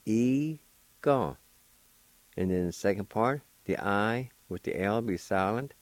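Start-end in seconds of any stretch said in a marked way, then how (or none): 0.1-1.3 "E, ga" said high, each syllable apart; raised, careful syllables; Cherokee pronunciation. Shown as a demonstration. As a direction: pronounce the first part syllable by syllable. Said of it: "The first part is pronounced like this".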